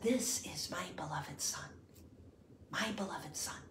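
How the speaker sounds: soft reverent whisper; hushed voice of God. Acted. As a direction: breathe the words as a sacred whisper. God the Father's voice quoted.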